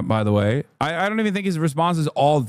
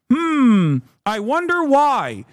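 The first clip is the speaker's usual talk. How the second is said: a drawn-out, mock-puzzled hmm, higher and slower; sarcastic wondering; 8 semitones higher, 3 dB louder, much slower than usual. Real, not acted. Streamer sarcastically asking his chat why, higher and slow. Sarcastic rhetorical question about lobby.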